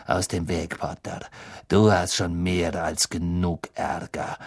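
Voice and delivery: low, controlled threat; dark, low-pitched menace; menacing Snape. A narrator's reading. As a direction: warn him off in a low, cold voice. Snape menacing Harry at the door.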